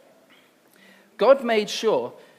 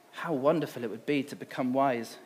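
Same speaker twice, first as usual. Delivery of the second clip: murmured; 4 semitones lower, 6 dB quieter than usual. A narrator's reading. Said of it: "Eve murmured".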